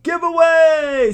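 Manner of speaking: drawn-out high shout of the word; excited announcement. Real, not acted. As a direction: hype it up like a game show host. Announcing the giveaway segment.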